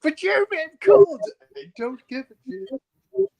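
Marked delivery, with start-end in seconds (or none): 0.0-1.2 high, loud whining plea; whiny pleading. Pretend. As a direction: beg like a freezing child. Pleading to be let in.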